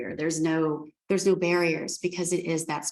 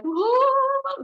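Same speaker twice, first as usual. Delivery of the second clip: much higher and louder startled whoa; 5 dB louder than usual. Retold or imitated. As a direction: yelp it out like a spooked animal. Animals reacting to the vet imagery.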